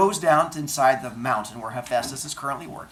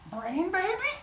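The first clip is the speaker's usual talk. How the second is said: much higher, incredulous ask; baffled question. Acted. Zeus asks Hephaestus in high disbelief. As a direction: squeak it in disbelief.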